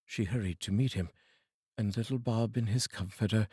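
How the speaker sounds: usual speaking voice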